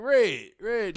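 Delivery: high, loud name-calling; mock yelling. Imitating callers who don't know his name, yelling. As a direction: call out insistently to a stranger. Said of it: "is yelling red".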